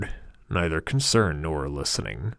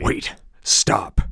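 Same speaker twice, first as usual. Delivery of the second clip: sharp "Wait, stop" shouted; 2 semitones higher, 6 dB louder than usual. A narrator's reading. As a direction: call out to stop him. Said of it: "I shout".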